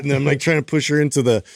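ordinary delivery

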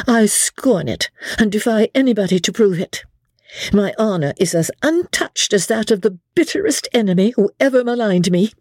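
high-pitched, scornful self-defence; indignant defiance. A narrator's reading. Rebecca defies Dobbin's accusation.

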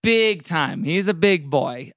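ordinary talk, nothing marked

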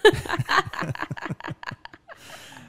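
high breathy laugh out loud; burst of laughter. Real, not acted. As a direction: laugh hard at the joke. Laughing at ambulance-chasing joke.

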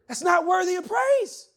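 loud, high shout of the phrase; shouted declaration. Acted. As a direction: hammer the line home at full voice.

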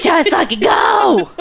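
high, slow, exasperated yell; hammed-up monster bellow of frustration; a cartoon monster losing patience, stretched out; Sully impression. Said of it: "I'm like Sully from Monsters College".

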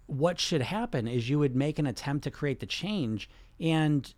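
normal talking voice

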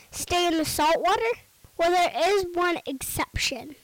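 ordinary talk, nothing marked

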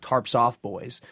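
ordinary talk, nothing marked

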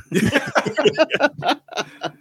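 a high, helpless laugh; high burst of laughter. Real, not acted. Friend laughing hard at a movie line. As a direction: crack up at the memory.

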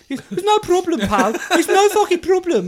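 high, loud mocking Birmingham accent; Brummie accent impression. The driver brushing off the bumped mirror in a Brummie accent. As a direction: ham up a smug Brummie telling him it's no problem. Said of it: "in his stupid act".

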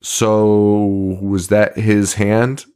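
very slow, drawn-out question; a hint of an unbothered reverend; slow deadpan question. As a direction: ask it slowly and calmly, as if it were nothing. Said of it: "the Reverend's like".

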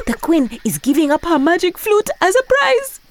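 high, loud eager hyena; squeaky cartoon hyena, thrilled; excited hyena voice.

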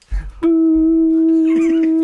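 high squeal. Pretend. Does the click sound.

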